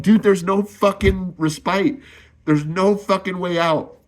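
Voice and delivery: pushing the words out, close to crying; near tears. Real, not acted. Husband pleading that nobody helps him, voice straining high and near tears. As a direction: voice strained high and breaking with despair. Man pouring out despair about marriage.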